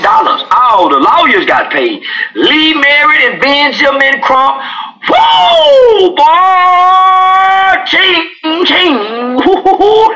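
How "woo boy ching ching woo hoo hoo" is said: high, loud whoops and cheers; whooping celebration. Acted. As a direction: whoop like lawyers cashing in. Lawyers celebrating the payday.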